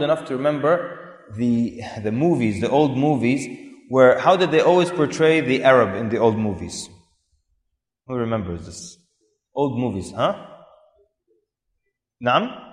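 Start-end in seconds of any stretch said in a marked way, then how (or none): none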